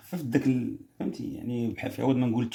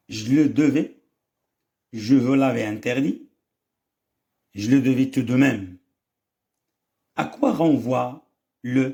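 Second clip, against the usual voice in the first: slow, drawn-out reading of the options; reading the question; much slower than usual. Shown as a demonstration. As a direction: spell out each choice slowly for the class. Reads text and question slowly. (قراءة بطيئة للسؤال)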